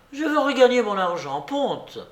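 put-on high, fast demanding old lady; insistent babouchka. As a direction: demand it quickly in a high, stubborn voice. Obstinate babouchka demands a bet. (vieille joueuse obstinée, débit rapide et aigu)

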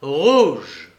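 loud one-word call; projected casino announcement; croupier's cry. A narrator's reading. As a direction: shout the result out. Croupier calls the result again.

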